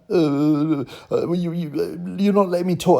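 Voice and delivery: lightly voiced young woman stammering; mimicked stammering woman. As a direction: she trips over her words trying to cut in. Mocking her stammering protest.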